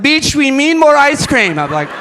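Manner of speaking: put-on high, loud Haitian accent, calling out orders in a rush. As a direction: shout it like a busy Haitian coworker hurrying you up in the kitchen. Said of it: "And he had a thick accent".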